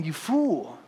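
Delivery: God's rebuke. Retold or imitated. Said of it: "And then feel God saying".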